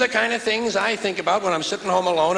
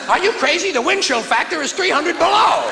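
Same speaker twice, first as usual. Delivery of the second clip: higher, louder outraged voice; wife's shouted refusal; 8 semitones higher, 4 dB louder than usual. Retold or imitated. Wife refusing her husband, high outraged shout. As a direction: yell back at the husband.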